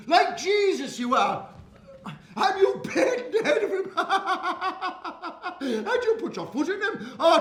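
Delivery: loud, high, jovial country shopkeeper, laughing as he talks; hearty, laughing shopkeeper. Acted. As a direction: clap the boy on the back with praise and laughter. Shopkeeper praises the boy.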